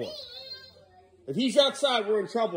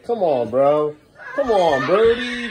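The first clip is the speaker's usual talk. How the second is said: loud exasperated call with laughter; laughing shout; 4 semitones higher, 8 dB louder, slower than usual. Real, not acted. Owner calling Brody off the rail, laughing shout. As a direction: yell at him while laughing. Dog throwing spaghetti over rail.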